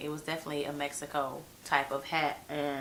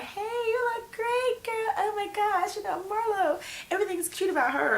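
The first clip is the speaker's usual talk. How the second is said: mock kiss-up. Kenya sucking up to Marlo in a mocking high gush. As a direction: gush fake flattery way up high. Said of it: "ever the kiss ass".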